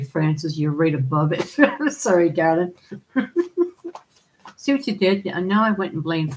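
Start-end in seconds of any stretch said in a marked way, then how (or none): 2.9-4.0 real giggle. Real, not acted. Apologizing to Francis for the mix-up, high giggling. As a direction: giggle up high after a slip.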